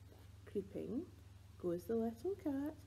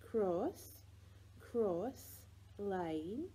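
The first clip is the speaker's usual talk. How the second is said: ordinary delivery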